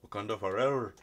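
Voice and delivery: low, soft attempt at a Wakandan accent; badly faked Wakandan accent; botched Wakandan accent. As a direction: try a Wakandan accent, quietly, and miss. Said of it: "the accent, that was".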